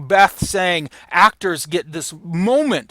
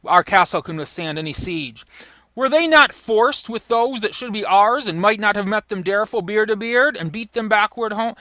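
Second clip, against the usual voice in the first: raised, declaiming a boastful king; high proud stage declamation; dramatic Shakespeare reading; 5 semitones higher, 3 dB louder than usual. Acted. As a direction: read it like a defiant warrior king. Reading Macbeth's defiant speech.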